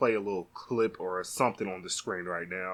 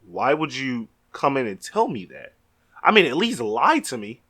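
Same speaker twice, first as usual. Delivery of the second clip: ordinary delivery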